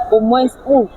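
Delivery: usual speaking voice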